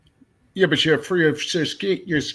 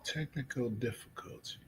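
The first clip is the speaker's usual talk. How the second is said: whispered aside; 2 semitones lower, 16 dB quieter than usual. Real, not acted. Whispered aside during tech trouble.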